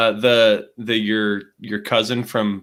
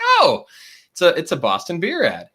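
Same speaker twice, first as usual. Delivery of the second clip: very high, louder oh of discovery; sudden realisation; 10 semitones higher, 5 dB louder than usual. Retold or imitated. Realising the ad is Sam Adams, high loud surprised oh. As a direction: let the realisation burst out. Realising it's a Boston Beer ad.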